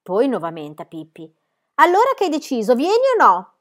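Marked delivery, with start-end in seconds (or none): 1.8-3.5 high, loud impatient demand; calling out. A narrator's reading. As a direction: shout up at her. Thug calls up to Pippi.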